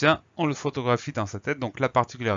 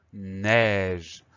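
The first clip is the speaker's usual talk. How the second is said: "neige" stretched slowly and clearly; drawn-out dictation; 3 semitones lower, 4 dB louder than usual. Shown as a demonstration. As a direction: draw out "neige" for pupils to spell. Teacher dictates new word slowly. (« neige » prononcé lentement, en allongeant)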